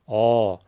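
آ low and full in the throat; throat-filled vowel. Shown as a demonstration. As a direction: puff the throat into a deep آ. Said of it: "بلکه از تو حلق پره". (آ پرحجم، باد در گلو)

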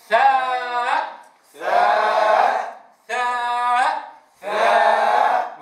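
each ثاء stretched long and loud, mouth open on the alif; teacher modelling ثاء slowly and clearly; drawn-out letter name. Shown as a demonstration. Quran teacher demonstrates ثاء to students, long and loud. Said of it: "كيف ننطقه؟".

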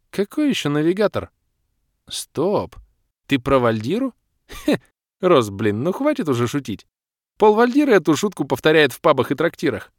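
fed up with a silly joke; incredulous protest. A narrator's reading. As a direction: scoff in loud disbelief. Kira disbelieves, scolds him for joking.

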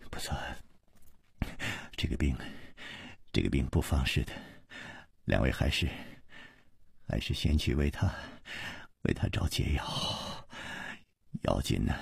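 very soft, faltering, repeated words; a sick man barely able to speak; weak, trembling. Acted. Chu Liuxiang urges them to seek the antidote, faint and faltering. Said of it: "嘴唇已经抖得连话都说不清楚了".

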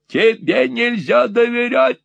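high angry screech; high shrill furious scold; shrieking. Acted. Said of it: "визжала женщина".

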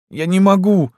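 high-pitched plaintive whine; whimpering girl. A narrator's reading. Lyuska told to undress by doctor, whimpering. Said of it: "захныкала Люська".

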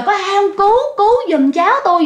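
high-pitched, hurried plea for help; retold cry for help. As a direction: imitate an aunt calling out for rescuers. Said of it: "Dì kêu quá trời". (giả giọng kêu cứu, cao và gấp)

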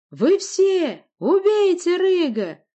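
high, loud, stretched scream; shrieked order. A narrator's reading. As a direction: scream the order at all of them. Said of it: "взвизгнула Власта".

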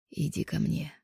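husky seductive murmur. Acted. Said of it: "таким хриплым голосом".

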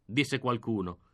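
ordinary delivery

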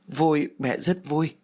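slow, choked-up reply through tears; mother holding back sobs, slowly; tearful. A narrator's reading. Mother answering her ghost son, slow and tearful. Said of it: "liền bật khóc".

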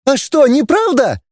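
very high, thin little voice; high-pitched indignant woman; thin-voiced lady. A narrator's reading. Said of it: "тонким голосом отозвалась пышная дама".

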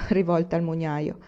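plain reading voice

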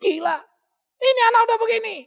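high, loud cry of a fed-up parent; exasperated parent. Retold or imitated. Preacher voices a parent fed up with a rebellious teen. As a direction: throw up your hands at the child.

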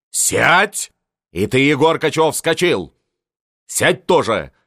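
loud high barking command; old marshal ordering guests back down; barked order. A narrator's reading. Marshal orders all to sit. (громко, властно приказать)

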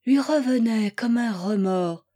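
usual speaking voice

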